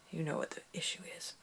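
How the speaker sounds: quick, secretive whisper; whispered aside. Real, not acted. Confiding to viewers about her health, whispered. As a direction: let them in on it under your breath.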